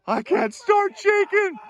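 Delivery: excited shout. Real, not acted. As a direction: blurt it out in wild excitement. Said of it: "I can't stop shaking".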